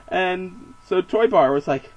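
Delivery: usual speaking voice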